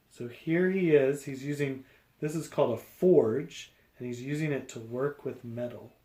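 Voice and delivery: usual speaking voice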